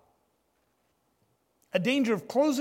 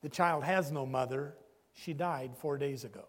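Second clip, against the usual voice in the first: lower, softer, grieving father's quiet answer; sad reply; 5 semitones lower, 5 dB quieter than usual. Retold or imitated. Grieving father answering the angry passenger, low quiet sadness. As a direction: let the grief sink the words, understated. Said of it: "And the father said sadly".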